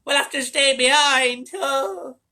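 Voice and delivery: high, loud, slow wail; wailing old woman. Acted. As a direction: wail like a beaten granny. Despairing grandma.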